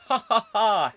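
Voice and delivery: loud, high "ha ha ha"; read-out mock laugh. Host reads a viewer's comment, loud mock laughing. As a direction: imitate a typed laugh, big and loud.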